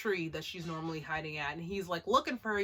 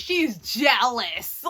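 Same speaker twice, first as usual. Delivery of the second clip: jealous drawn out slowly; stressed jealous; 9 dB louder, much slower than usual. Shown as a demonstration. Explaining to viewers, she's jealous with slow stress. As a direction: stretch "jealous" slow and loud. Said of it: "Kon is jealous".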